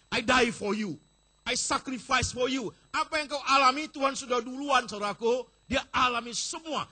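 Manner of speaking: loud, ringing sermon voice; preaching at full force. Acted. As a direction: preach it to the back row.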